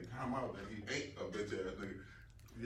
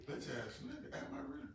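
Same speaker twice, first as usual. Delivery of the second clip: mock hurt soul; 6 dB quieter than usual.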